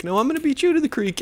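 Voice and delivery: quick high falsetto comeback; mock kid retort. Second kid answering the bike challenge, fast mock falsetto. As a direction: fire back as the rival kid, fast and high. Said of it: "they're like".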